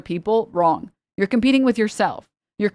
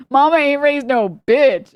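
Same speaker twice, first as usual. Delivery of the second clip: higher, louder and slow, chest out; louder hammed-up boast with a grin; proud mock boast; 6 semitones higher, 5 dB louder, slower than usual. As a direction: deliver it like a proud family motto. Quoting proud saying.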